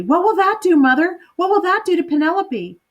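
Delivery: eager daughter bursting with questions; high, excited little girl. Acted. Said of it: "bounced up and down excitedly".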